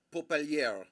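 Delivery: Popelier said as pop-el-YAIR; pronounced Popelier. Shown as a demonstration. Streamer says a viewer's name Popelier as pop-el-YAIR. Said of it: "I choose to say his name".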